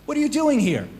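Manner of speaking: high, startled question; a storyteller's shocked warrior. Acted. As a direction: jump up in pitch in disbelief. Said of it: "The warrior's shocked".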